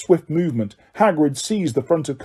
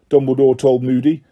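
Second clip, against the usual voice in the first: ordinary delivery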